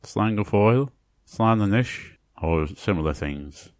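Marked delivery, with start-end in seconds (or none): 0.0-0.9 "Slán go fóill" said as "slawn guh foyle", stress on "fóill"; Irish goodbye. Shown as a demonstration. Teacher listing Irish farewells, careful pronunciation. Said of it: "you can say". 1.3-2.2 "Slán anish" said as "slawn a-nish", stress on "Slán"; Irish goodbye. Shown as a demonstration. Teacher listing Irish farewells, careful pronunciation. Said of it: "you can say".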